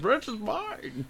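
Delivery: slow villain echo. Pretend. Repeating cohost's line slowly.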